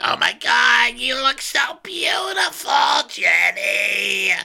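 high, loud gushing greeting; gushing shout. Retold or imitated. Emily greeting Jenny, high gushing squeal. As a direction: squeal hello at a friend. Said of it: "And she's like".

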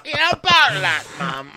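very high, loud put-on voice; high-pitched hammed-up reply to Mum; mock child to mum. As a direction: squeak it out as somebody's kid. Acted reply to mum in skit.

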